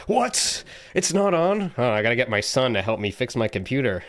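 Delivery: shocked boomer who can't work computers; boomer impression. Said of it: "boomer impression".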